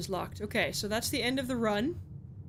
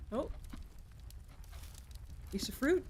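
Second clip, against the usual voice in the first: ordinary talk, nothing marked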